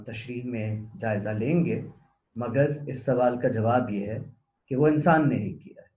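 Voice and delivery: usual speaking voice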